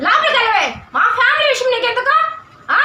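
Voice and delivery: high-pitched, indignant order; angry rebuff. Acted. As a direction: send her packing. Ordering interfering relative away.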